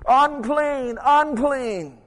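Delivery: high, loud drawn-out call; leper's warning cry. Acted.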